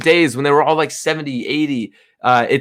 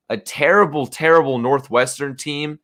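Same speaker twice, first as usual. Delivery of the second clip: ordinary delivery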